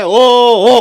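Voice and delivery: loud, high hammed-up group wail; mock wailing crowd. Comedian acts mourners wailing into an engagement party. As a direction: bellow it like twenty-two mourners bursting in.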